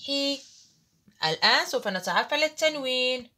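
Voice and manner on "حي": حي held as a long "ḥii", pitched high; teacher sounding a long vowel. Shown as a demonstration. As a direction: stretch حي into a long i. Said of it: "أقول له أيضًا".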